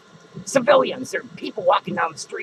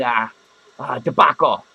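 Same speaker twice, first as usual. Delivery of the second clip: ordinary delivery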